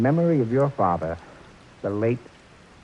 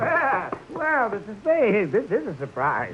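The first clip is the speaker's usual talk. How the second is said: drawn-out Mister Spade; slow drawl; 8 semitones higher, 4 dB louder, slower than usual. Acted. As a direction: stretch it out, gangster charm. Gentle Joe's slow drawling greeting.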